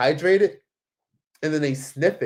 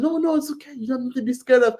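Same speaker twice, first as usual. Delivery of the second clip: high-pitched imitation of a persistent man; insistent reassuring stranger; 11 semitones higher than usual. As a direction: mimic a stranger talking away someone's fear. Voicing stranger insisting it's okay.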